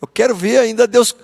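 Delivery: a hammed-up prophet itching to see fire; mock-gleeful Jonas craving chaos; eager for destruction. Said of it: "É como se ele estivesse dizendo assim".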